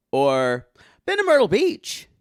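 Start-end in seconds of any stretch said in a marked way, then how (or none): 1.1-2.1 mock tourist. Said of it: "people go".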